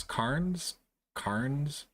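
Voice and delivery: Cairns as KARNZ, rising in question; unsure pronunciation. Shown as a demonstration. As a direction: test Cairns as KARNZ with the r sounded. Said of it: "I had difficult with".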